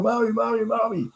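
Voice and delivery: put-on very high-pitched child calling out; mock child yelling. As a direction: imitate kids pestering their mother. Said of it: "by yelling".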